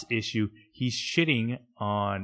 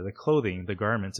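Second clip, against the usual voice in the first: ordinary talk, nothing marked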